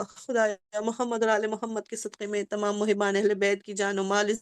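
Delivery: low, soft, pleading supplication; closing dua of the majlis; prayer. Real, not acted. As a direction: pray quietly for the congregation.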